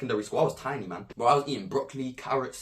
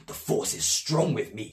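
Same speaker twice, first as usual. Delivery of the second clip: movie line; 4 semitones lower, 4 dB quieter than usual. Retold or imitated. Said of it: "I'm like".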